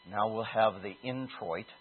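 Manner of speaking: low, slow, lifeless announcer voice; mock dry liturgy. Preacher mocking a dull church service, lifeless drone. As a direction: drone it like a bored officiant.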